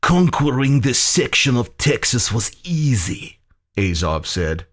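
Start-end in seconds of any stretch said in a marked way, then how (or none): 0.0-3.4 raised-pitch villain's gloat; boastful Soviet general. A narrator's reading.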